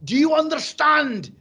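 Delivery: high, loud, slow Scottish demand; hammed-up Scottish coach shout. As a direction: bellow it in a thick Scottish accent. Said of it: "the coach said".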